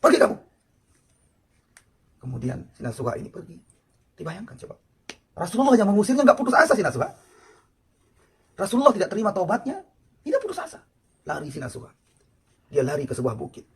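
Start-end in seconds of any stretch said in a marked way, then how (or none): 0.0-0.4 angry. Retold or imitated. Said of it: "Marah Nabi". 2.3-10.8 loud, high impassioned sermon; preacher heating up over the sinner's deed; fiery preaching. Acted. Preacher rising to full force.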